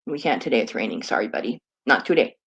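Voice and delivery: low soft apology to the dog; gentle soothing. Real, not acted.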